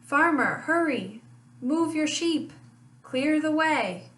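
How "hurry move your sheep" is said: ordinary delivery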